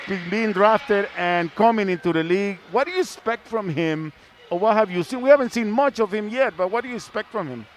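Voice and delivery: usual speaking voice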